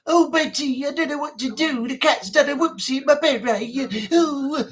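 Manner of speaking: Frank Spencer. Shown as a demonstration. Hapless man confessing to Betty about the cat, strained head-cold voice. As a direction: hold the breath and push the voice through. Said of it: "sound like you have a cold".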